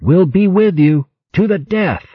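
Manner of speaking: high, loud rallying cry; calling out. Acted. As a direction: shout it across the deck. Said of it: "as he called out".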